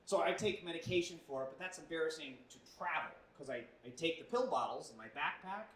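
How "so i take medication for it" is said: ordinary talk, nothing marked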